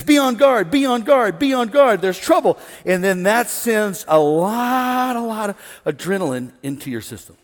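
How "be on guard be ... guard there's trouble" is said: alarm voice. Acted. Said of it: "and it says".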